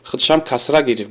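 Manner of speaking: ordinary delivery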